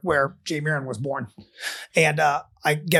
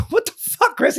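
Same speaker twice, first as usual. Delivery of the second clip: incredulous. Retold or imitated. Incredulous outburst at friend.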